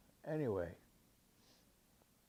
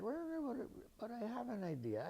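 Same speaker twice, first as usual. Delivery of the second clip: sudden idea; 11 semitones higher than usual. Retold or imitated. Menotti suddenly gets an idea.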